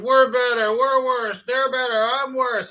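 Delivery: high, mocking singsong of the ego. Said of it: "the separated ego mind ... stay separate".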